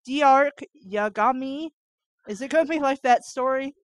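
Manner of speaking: ordinary delivery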